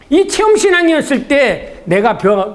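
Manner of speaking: ordinary talk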